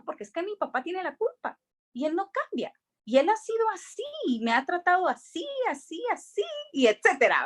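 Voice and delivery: a daughter blaming her father, talking back to God; indignant complaint. Retold or imitated. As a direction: pour out the grievance up high. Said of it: "Y yo decía".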